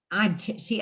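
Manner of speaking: ordinary talk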